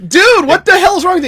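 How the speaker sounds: loud, high, hammed-up outrage; indignant outburst. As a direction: act shocked and offended, half joking. Retold reply to buddy's insult.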